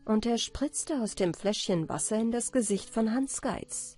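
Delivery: plain reading voice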